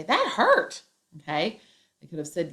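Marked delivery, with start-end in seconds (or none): 0.0-0.8 sharp yell, much higher and louder; pained shout of "That hurt". Shown as a demonstration. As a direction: cry out as if it really hurt. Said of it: "You're gonna say".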